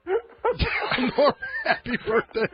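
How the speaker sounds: slow, breaking into laughter; can't get through it without laughing. Real, not acted. Cracks up repeating it.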